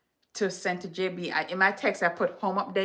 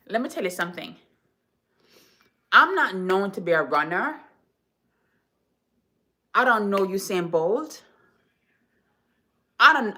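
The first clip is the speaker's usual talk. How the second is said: ordinary talk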